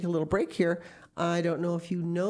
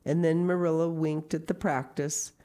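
ordinary delivery, nothing marked

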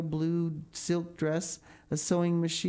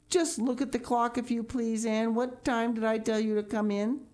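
put-on higher, reproachful older woman; stern Marilla; 7 semitones higher than usual. A narrator's reading. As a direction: scold a late child.

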